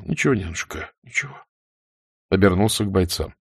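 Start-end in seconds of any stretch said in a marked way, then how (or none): 0.0-1.4 soft, hushed reassurance; whispered comfort. A narrator's reading. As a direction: comfort her under your breath. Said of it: "Владимиров шепнул". (утешающий шёпот)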